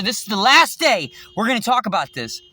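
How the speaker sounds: ordinary delivery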